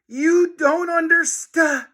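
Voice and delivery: high, slow cut-off plea; desperate boy pleading. A narrator's reading. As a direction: get cut off mid-word. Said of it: "Harold stammered".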